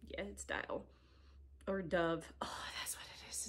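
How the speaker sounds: soft whisper to herself; whispered realization. Real, not acted. Vlogger muttering to herself about the soap smell, whispering. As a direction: whisper it as a private aha.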